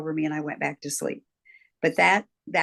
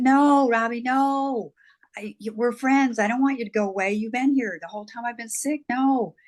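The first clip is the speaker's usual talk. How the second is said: retelling her small self begging, pitched up; imitated child pleading; 6 semitones higher than usual. Small girl begs friend Robbie not to leave, higher pleading.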